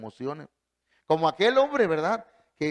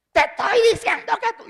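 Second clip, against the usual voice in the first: shrill-high furious shout, much louder, mocking a teacher; imitated yelling teacher; 9 dB louder than usual. Preacher imitating a teacher shouting at a child, furious. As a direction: bark it like a teacher losing her temper at a child.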